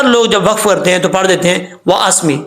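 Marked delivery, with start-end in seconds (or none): 1.9-2.4 "وَأَسْمِعْ" cut short after mee; final ain dropped. Shown as a demonstration. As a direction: leave the closing ain off "وَأَسْمِعْ". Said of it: "میم کے بعد جو عین ہے اس کو چھوڑ دیتے ہیں". ("وَأَسْمِعْ" آخری عین چھوڑ کر)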